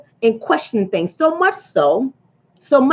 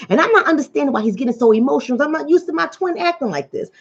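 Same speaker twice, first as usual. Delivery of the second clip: ordinary talk, nothing marked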